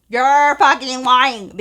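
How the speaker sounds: slow, loud, stretched-out disbelief. Retold or imitated. Recognizing an old classmate at a party, slow loud disbelief. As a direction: let it burst out slowly.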